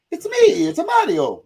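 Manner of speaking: very high, loud Italian cartoon cry; Mario. Shown as a demonstration. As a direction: squeal it in a hammed-up Italian accent. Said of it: "Mario's like this".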